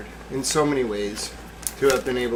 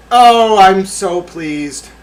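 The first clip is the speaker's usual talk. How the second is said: savoring delight; 6 semitones higher, 10 dB louder, much slower than usual. Real, not acted. Drawn out delight.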